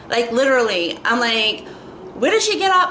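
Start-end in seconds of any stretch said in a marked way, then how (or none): 2.2-2.9 sharply higher, louder outraged question; higher, louder disbelieving "did"; indignant disbelief. Retold or imitated. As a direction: squeak it out in disbelief. Indignant reaction quote.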